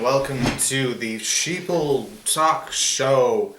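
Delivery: slow show-host welcome; drawn-out host greeting; announcer voice. Acted.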